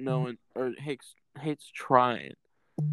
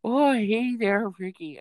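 much higher, louder put-on greeting voice; Bubbles impression; 8 semitones higher, 3 dB louder than usual. Podcast host trying Bubbles, high and hammed-up. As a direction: greet Ricky in a high, silly cartoon voice. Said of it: "I can't do it".

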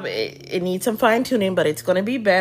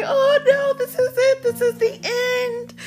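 high, wailing mock cry of despair; mock sobbing. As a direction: wail it like the world is ending. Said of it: "I was boo-hooing".

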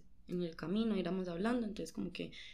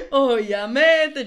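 high, loud laughing-crying confession; 10 semitones higher, 19 dB louder than usual. Real, not acted. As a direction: break into shrieking laughter. Confiesa lo de la manilla. (risa llorosa, aguda y fuerte)

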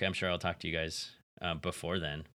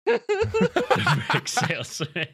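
laughing; 7 dB louder, much slower than usual. Real, not acted. Echoing joke through laughter.

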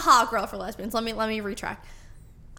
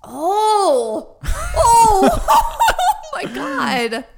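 rising laugh, oh my God; 9 semitones higher, 7 dB louder, much slower than usual. Real, not acted. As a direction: breaks into loud laughter. Bursts out laughing at memory.

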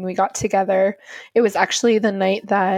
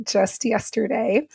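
normal talking voice

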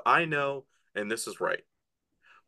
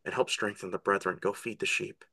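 softly acted forgiveness, a little low; Jesus to Peter; 6 semitones lower, 5 dB quieter than usual. Jesus forgiving Peter and sending him forth, softly acted. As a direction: send him off with quiet, understated tenderness. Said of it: "It just says".

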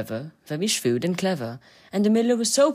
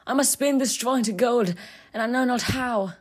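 maiden voice; 8 semitones higher than usual. Acted. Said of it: "said she".

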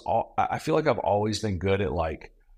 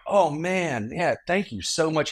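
higher, mock-gushing thank you; lightly hammed-up pleased thanks; mock flattered thanks; 8 semitones higher than usual. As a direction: play the flattered young guy. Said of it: "And I go".

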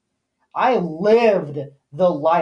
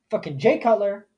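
ordinary talk

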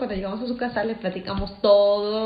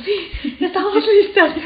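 higher, louder, giggling with excitement; laughing eager yes; 10 semitones higher, 6 dB louder than usual. Retold or imitated. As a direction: blurt it out ready for the adventure. Eager reply to the risky plan.

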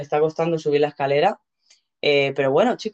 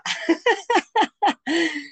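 bursts out in high laughter; 12 semitones higher than usual. Real, not acted. Laughs at own joke.

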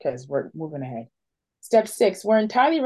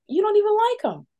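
much higher, knowing imitation of the therapist; imitating therapist; 13 semitones higher than usual. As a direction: point it out lightly, pitched way up. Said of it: "my therapist always goes".

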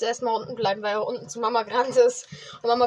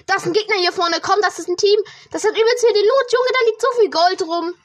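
rising, louder and higher; hyped-up callout of enemies; excited; 6 semitones higher, 5 dB louder than usual. Real, not acted. Spotting enemies and loot. (aufgeregt und laut)

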